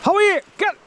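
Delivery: squeaky greeting. Real, not acted. Sudden line to dog.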